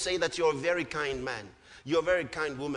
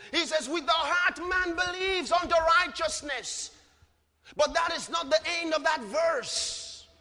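fiery preaching; 7 semitones higher than usual. Acted. Preacher to congregation, full-force preaching.

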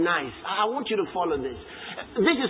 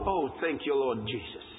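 much softer, lower thanks to the Lord; murmured aside; 8 semitones lower, 7 dB quieter than usual. Real, not acted. Preacher pausing the reading to thank Jesus, soft aside. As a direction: drop away for a private word of thanks.

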